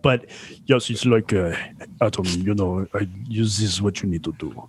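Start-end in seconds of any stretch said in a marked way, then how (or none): 2.0-4.7 put-on low-pitched, clumsy Russian accent; rough Russian accent. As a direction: imitate a Russian doctor, badly. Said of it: "can't do a really good Russian accent".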